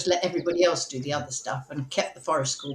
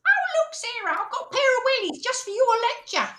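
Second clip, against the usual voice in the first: put-on Essex accent.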